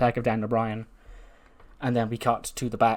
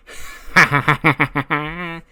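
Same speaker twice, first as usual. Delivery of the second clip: loud, higher staccato cackle; villainous laugh; 4 semitones higher, 8 dB louder than usual. Acted. Wrestler taunting rivals in a promo, villainous cackle.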